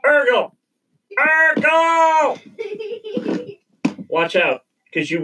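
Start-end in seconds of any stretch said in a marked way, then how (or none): 0.1-2.4 building to a high yell; shouting a name. Real, not acted. Calling partner to stop.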